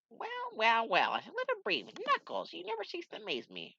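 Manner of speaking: high put-on voice for an old mentor. Mentor greeting Knuckles, in a high put-on voice. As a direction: play the wise old master, pleased.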